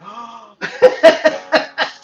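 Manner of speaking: sharp high gasp of a scandalized mom; shocked gasp. Retold or imitated. Mom catching her son on a live stream, mock gasp. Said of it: "and my mom is like".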